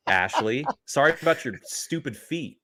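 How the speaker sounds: usual speaking voice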